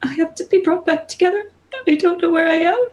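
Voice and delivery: high, tearful goddess voice; a lost god pleading; weeping goddess. Acted. Goddess answering the warrior Sedna, tearful and high.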